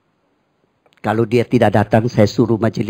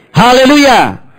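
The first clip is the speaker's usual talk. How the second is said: much higher, loud hallelujah cry; 12 semitones higher, 8 dB louder, slower than usual. Acted. Pastor raises Haleluya higher for the congregation.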